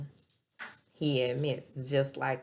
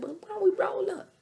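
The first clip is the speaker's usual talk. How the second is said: much higher, loud and playful; high mock voice; 7 dB louder than usual. Cousin suggesting they roll up, high and loud. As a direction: squeak it up high.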